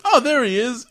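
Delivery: high, loud mock realisation; imitated bright "found him" call; sudden spotting. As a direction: exclaim as you spot someone. Kai spots Takato coming.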